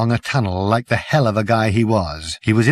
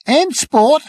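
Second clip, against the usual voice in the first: loud; 5 dB louder than usual. A narrator's reading.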